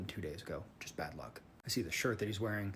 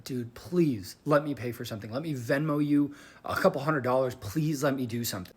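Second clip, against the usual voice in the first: loud, raised-pitch plea; retold pleading; 5 semitones higher, 9 dB louder than usual. Driver begging the other man to let him pay, urgent pleading. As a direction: press him urgently to accept, louder and higher.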